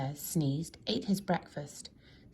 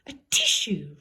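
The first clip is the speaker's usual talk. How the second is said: sneeze; 9 dB louder than usual. A narrator's reading. Sneeze voiced as word.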